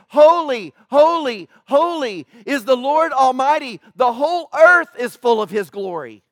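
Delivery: loud, exalted calling out. Retold or imitated. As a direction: cry it out like angels calling across the throne room. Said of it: "they're calling out to each other".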